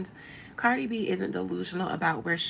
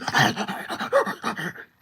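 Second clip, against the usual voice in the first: loud mock panting and slobbering; imitated dog noise; 3 semitones higher, 4 dB louder than usual. Commentator mimics blogger lunging for a kibble, dog noises. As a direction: scramble noisily like an eager dog. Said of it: "And you".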